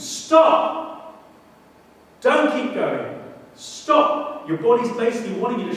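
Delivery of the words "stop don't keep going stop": sharp, high, loud yells of stop; screaming stop. Retold or imitated. The preacher voices a runner's body screaming at him to stop. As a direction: shout it like your body begging to quit. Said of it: "everything inside of you screams".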